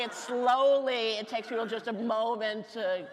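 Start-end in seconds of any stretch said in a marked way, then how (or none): none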